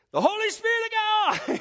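frantic preacher yell. Retold or imitated. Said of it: "he yells it out".

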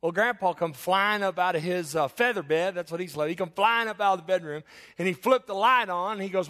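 normal talking voice

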